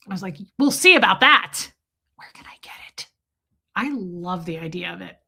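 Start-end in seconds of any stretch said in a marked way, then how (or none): 0.6-1.7 loud, raised retort; defiant comeback. Retold or imitated. Patient defying therapist's advice, loud defiance. 2.1-3.1 hushed conspiratorial whisper; mock-furtive whisper. Asking around for Adderall, mock-secret whisper.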